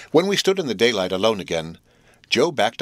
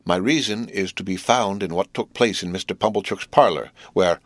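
usual speaking voice

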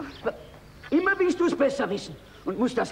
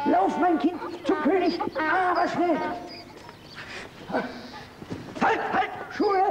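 urgent; 8 semitones higher, slower than usual. Acted. Father urging daughter to hurry.

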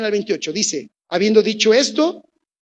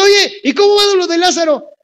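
casual Jesus voice; 10 semitones higher, 8 dB louder than usual. Retold or imitated. Jesus voiced in comic retelling.

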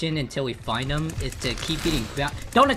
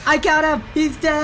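high, loud victory yell; triumphant shout; 11 semitones higher, 8 dB louder than usual. Real, not acted. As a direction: shout the win. Triumph after the kill.

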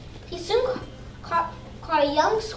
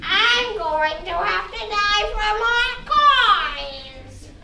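very slow, drawn-out spooky sprite; water sprite voice; 4 semitones higher, 3 dB louder, much slower than usual. Acted. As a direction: stretch every word like a lake spirit.

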